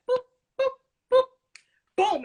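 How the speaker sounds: short, spaced boops ending on a boom; imitated count-in beat. Dancer showing the beat cue before the first move, imitated beeps. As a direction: mimic a beep count-in, pausing between beats. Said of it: "give you a little beat like".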